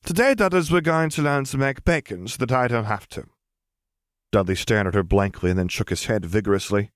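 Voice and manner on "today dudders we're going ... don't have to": put-on high, bossy little girl. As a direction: lecture like a know-it-all child. Lily instructing cousin Dudley.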